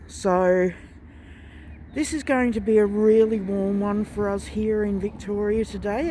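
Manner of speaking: muffled behind a mask; talking through a face mask. Real, not acted. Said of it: "If I sound a bit funny, it's because I'm wearing a mask".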